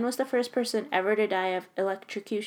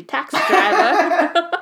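slow and louder, laughing through it; 8 semitones higher, 8 dB louder, slower than usual. Real, not acted. Reading the quote aloud and cracking up at it. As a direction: lose it on the last words.

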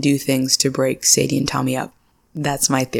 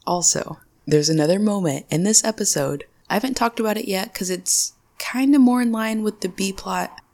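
usual speaking voice

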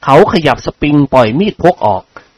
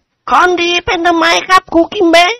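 much higher, cheeky student voice. A narrator's reading. Student mocking his new teacher, high cheeky voice. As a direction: tease the teacher like a smart-mouthed pupil. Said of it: "เสียงนักเรียนคนหนึ่งพูดขึ้นดังๆ".

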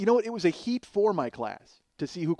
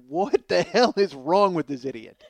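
mock incredulous; 8 dB louder than usual. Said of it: "everybody else is just like".

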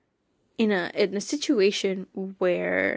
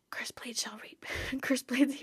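awed, hushed whisper of the cursed blade line; imitating brother's gasp; 3 semitones higher, 6 dB quieter than usual. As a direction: whisper it like a reverent movie quote. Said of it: "what did my brother say? He goes".

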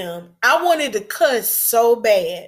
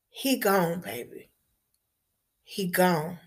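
softer, hushed, breaking the news tenderly; gentle comfort; 4 semitones lower, 8 dB quieter than usual. Retold or imitated. Sunday school teacher tells the grieving girl her father is gone, hushed and tender. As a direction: say it low and soft like comforting a child.

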